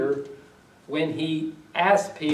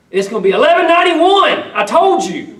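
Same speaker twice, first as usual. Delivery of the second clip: much higher, louder exasperated yell; aggravated shout; 12 semitones higher, 11 dB louder than usual. Retold or imitated. Boss telling the caller the address a third time, exasperated yell. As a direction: bark it out, fed up with repeating.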